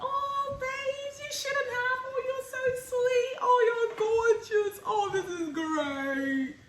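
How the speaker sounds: high, loud, singsong gushing over a gift; gushing delight. Retold or imitated. Girlfriend gushing over the boyfriend's Valentine's gift, high and sung. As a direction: overdo the sweet thank-you, almost singing.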